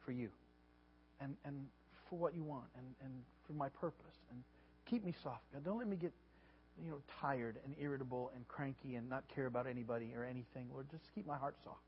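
soft, humble sample prayer; quiet prayer. Acted. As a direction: pray gently, as if alone with God. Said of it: "we have to ask God continually".

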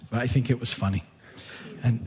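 usual speaking voice

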